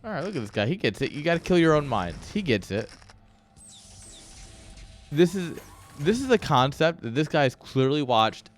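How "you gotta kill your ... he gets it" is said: normal talking voice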